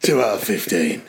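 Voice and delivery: very low hard-man teacher voice; Jason Statham. Retold or imitated. Teacher handing back a failed test, mock hard man. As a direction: give the mark as a deep action-hero verdict. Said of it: "Like Jason Statham".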